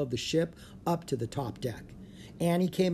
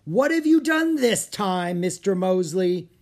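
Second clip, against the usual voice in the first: loud, high, drawn-out captain's demand; shouted, exasperated reprimand; angry shout; 4 semitones higher, 7 dB louder than usual. A narrator's reading. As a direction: thunder it at a subordinate.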